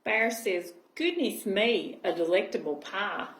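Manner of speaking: gentle storybook reading voice; cosy read-aloud storytelling; reading to children. Acted. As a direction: read it like a bedtime picture book. Reading picture book aloud.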